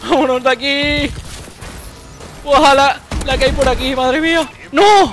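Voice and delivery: high, loud panicked yelling; panicked shouting. Real, not acted. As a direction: yell to flee in panic. Fleeing enemies in game.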